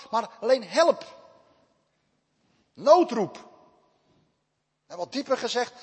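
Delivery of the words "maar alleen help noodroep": louder, ringing "help" and "Noodroep"; emphatic call. Acted. Preacher to congregation, stressing the emergency call loudly. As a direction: hammer home the distress call.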